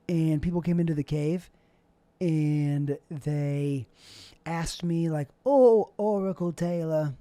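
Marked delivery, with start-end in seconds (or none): 5.5-7.1 slow, drawn-out Brooklyn greeting to the oracle; Brooklyn accent. Pretend. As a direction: greet the oracle like a Brooklyn guy, taking your time. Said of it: "Why is every impression I do a, a person from Brooklyn?".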